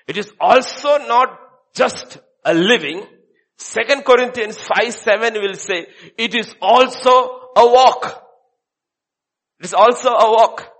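loud, high-pitched sermon delivery; forceful preaching. Real, not acted. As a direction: drive the point home to the congregation.

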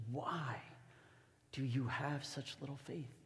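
soft question with a long pause after "Why"; hushed reproach. Acted. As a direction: ask it quietly, letting "Why" hang. Jesus questions disciples, first take.

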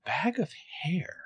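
whispered, slow and bewildered; confused whisper. Pretend. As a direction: breathe it out baffled. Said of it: "I would be like".

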